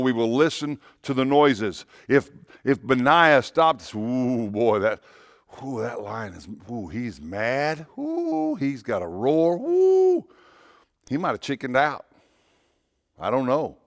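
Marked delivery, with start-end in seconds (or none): 3.9-10.2 mock scared. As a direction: play a jittery man backing off the lion. Benaiah scared by lion.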